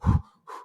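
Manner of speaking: hard, voiceless puffs of breath; imitated hyperventilating. Teller re-enacting panic in the car, hyperventilating breaths. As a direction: act out panicky over-breathing. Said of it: "I just started hyperventilating".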